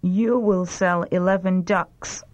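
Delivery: normal talking voice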